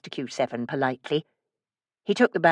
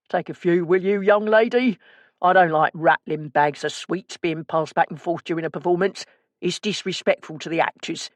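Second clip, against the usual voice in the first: put-on East End accent. A narrator's reading. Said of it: "low and gruff, containing a twang of the East End".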